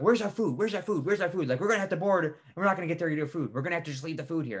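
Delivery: high-pitched, hurried imitation of panicking customers; mock freaking out. Travellers about to board demand their food, imitated freaking out. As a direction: rattle it out like frantic travellers. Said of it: "freaking out".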